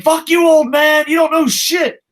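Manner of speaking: high, loud jeer at an elder; hammed-up heckler's yell; mock abusive shout. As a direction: imitate hecklers yelling their contempt. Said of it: "I'm the old guy who gets shit on".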